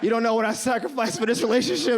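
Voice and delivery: hammed-up tearful confession; mock crying. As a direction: fake a sob while pleading love. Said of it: "and I'm crying".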